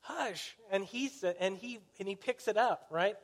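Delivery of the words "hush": high, softened telling-off; mock scolding hush. Crowd tells the shouting blind man to be quiet, high hushed scolding. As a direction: shush someone in a high, hushed voice. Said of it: "people are going".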